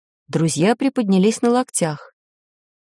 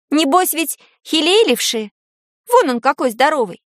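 put-on higher-pitched, teasing girl's voice; needling girl; 7 semitones higher than usual. A narrator's reading. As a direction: goad him in a light, raised voice. Girl goads the giants.

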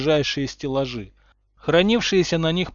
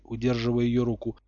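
usual speaking voice

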